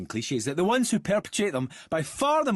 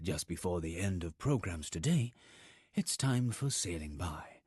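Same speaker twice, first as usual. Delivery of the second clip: low, soft, soothing radio voice; late-night continuity announcer; 10 semitones lower, 8 dB quieter than usual. Acted. Announcer closing the day's programmes, soft and low. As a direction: hush it down for closedown.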